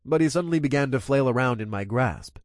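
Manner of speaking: ordinary delivery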